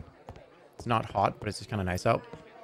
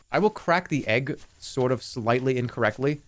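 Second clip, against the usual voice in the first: ordinary talk, nothing marked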